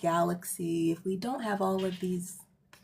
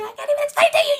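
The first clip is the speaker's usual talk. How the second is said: high, loud laughing outburst; laughing shout; 12 dB louder, much faster than usual. Real, not acted. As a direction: burst out laughing in disbelief. Gushing about the sun's portals.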